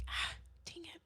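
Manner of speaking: a soft, breathless whisper of defeat; whispered grumble. Retold or imitated. Teen giving in to God, grumbling in a whisper. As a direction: mutter it under your breath, giving in. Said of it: "And I was like".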